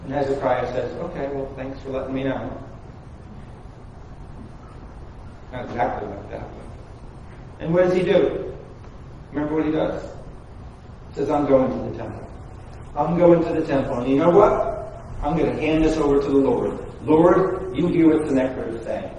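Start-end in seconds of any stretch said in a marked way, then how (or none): 0.0-10.0 much softer, lower aside; quiet. Acted. Quiet retelling. 11.3-19.1 soft, lower Hezekiah voice; quiet resolve. Acted. Voicing Hezekiah.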